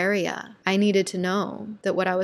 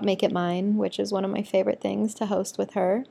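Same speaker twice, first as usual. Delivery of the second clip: usual speaking voice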